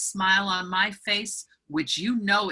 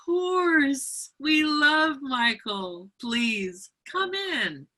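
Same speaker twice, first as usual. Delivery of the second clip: much higher, syrupy, slowing way down; sudden sugary welcome; 10 semitones higher, much slower than usual. Retold or imitated. Homeowner suddenly welcoming the nephew's aunt, sugary and fake. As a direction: flip instantly into fake sweetness. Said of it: "to like, "Oh, yes".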